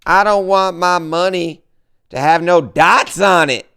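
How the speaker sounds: mock outrage. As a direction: imitate someone losing their mind over money. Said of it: "people would lose their mind".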